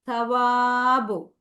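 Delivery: സവാബു very slow, long വാ; drawn-out syllables. Shown as a demonstration. Teacher models the word for the class, drawn-out syllables. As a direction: stretch the വാ in സവാബു. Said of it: "നമ്മൾ വാ".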